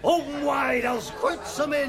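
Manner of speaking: high, hammed-up pirate growl. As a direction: ham it up like a party pirate. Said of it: "Why are you talking like a pirate?".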